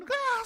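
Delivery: high thrilled shriek of a fan; fan scream. Retold or imitated. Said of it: "소리 지르면서".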